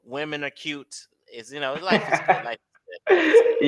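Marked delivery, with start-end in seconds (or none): 2.5-3.2 life is good, called out louder and bolder; louder, bright-voiced exclamation; exclaimed. Real, not acted. Repeating his own phrase. 3.2-3.7 life is good, jumped way up high and loud; high, loud, squealing exclamation; squealed. Real, not acted. Repeating his own phrase.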